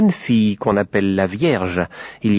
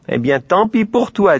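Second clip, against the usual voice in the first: petit Dieu grondeur; 9 semitones higher than usual. Acted.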